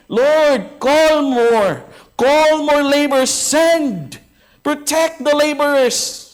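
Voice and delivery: high, loud pleading cry to the Lord; fervent shouted prayer. Shown as a demonstration. Pastor shows the church how to pray at the alarm, loud and high. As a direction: call out the prayer with full force. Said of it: "Tugunan ang prayer request ng Panginoong Jesus to pray".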